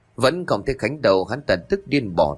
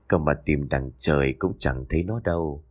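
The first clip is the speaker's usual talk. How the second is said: low, sly villain's gloat; sinister gloating; 8 semitones lower, 3 dB quieter than usual. A narrator's reading. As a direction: savour the secret with quiet menace. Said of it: "cười nham hiểm cất tiếng".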